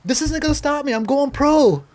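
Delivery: loud, high vow in retelling; defiant shout. Retold or imitated. Young skater telling mom about turning pro, defiant shout. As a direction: proclaim it with stubborn conviction.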